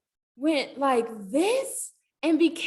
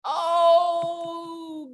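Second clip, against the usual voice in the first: ordinary delivery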